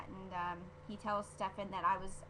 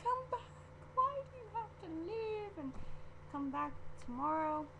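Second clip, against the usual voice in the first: high, hammed-up whine; playful high-pitched lament; mock pleading; 10 semitones higher than usual. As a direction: beg the show not to end, pitched way up. Begging the episode not to end.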